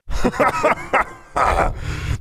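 big, high-pitched monster guffaw; booming sphinx laugh; roaring laughter. A narrator's reading. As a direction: laugh long and loud, head thrown back. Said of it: "roared with laughter".

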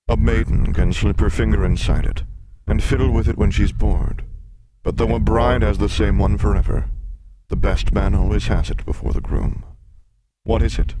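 loud, booming monster voice; riddling sphinx. Acted. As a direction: pose the riddle as a big beast.

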